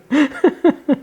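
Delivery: high-pitched laugh at herself; amused little giggle; giggling at her own impression. Real, not acted.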